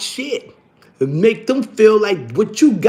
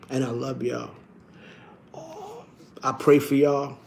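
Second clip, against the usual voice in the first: much softer, low, close to tears; choked-up farewell; 4 semitones lower, 9 dB quieter than usual. Real, not acted. Mourning his friend to viewers, real choked-up softness. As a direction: let the grief catch in your throat.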